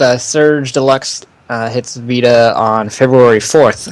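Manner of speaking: ordinary talk, nothing marked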